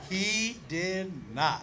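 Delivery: very slow, low and soft; hushed, drawn-out punch line; deadpan denial. Real, not acted. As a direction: space out each word, quietly.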